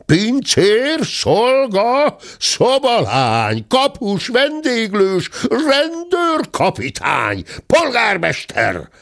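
high, drawn-out calls for help; frantic acted summoning; repeated yelling. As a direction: yell out each title high and stretched. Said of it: "miközben egyre ordítozott".

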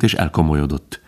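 usual speaking voice

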